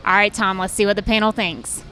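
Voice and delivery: usual speaking voice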